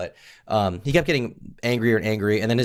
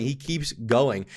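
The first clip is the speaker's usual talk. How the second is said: ordinary talk, nothing marked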